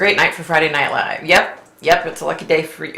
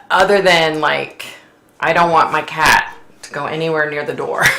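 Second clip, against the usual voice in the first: normal talking voice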